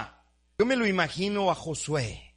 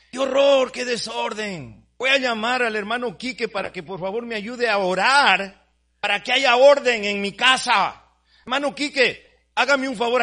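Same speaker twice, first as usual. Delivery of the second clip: mock panic; 4 semitones higher, 5 dB louder than usual. Mocking lazy believer's panic.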